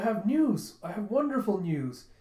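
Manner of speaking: can barely hold in the good news; bursting with news. Retold or imitated. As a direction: retainer excitedly announcing news.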